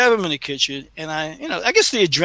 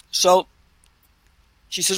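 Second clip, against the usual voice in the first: normal talking voice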